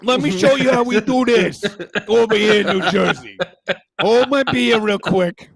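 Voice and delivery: swagger it out like a Jersey show-off; a hint of a boastful fan; mock New Jersey bravado. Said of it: "that's what it feels like".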